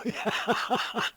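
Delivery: high, loud burst of laughing; real loud laughter. Real, not acted. Player falling off in game, laughing hard. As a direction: burst out laughing at your mishap.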